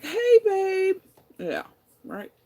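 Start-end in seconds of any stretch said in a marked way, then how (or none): 0.0-1.0 high, sugary mock of his "Hey, babe"; mock sweet greeting. Mocking her partner's sweet text message, sarcastic sing-song. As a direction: parody his fake sweetness.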